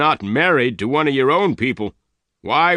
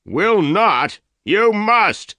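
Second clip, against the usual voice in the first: much higher, loud furious shout; 12 semitones higher, 5 dB louder, much slower than usual. A narrator's reading. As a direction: shout the command at her. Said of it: "Dyer shouted".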